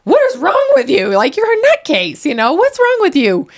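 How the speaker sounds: high, loud self-scolding at the mirror; scolding herself. Retold or imitated. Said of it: "I would look in the mirror and be like".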